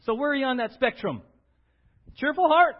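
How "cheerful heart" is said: bright cheer. Shown as a demonstration. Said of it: "A cheerful heart is good medicine".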